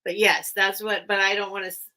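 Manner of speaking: ordinary delivery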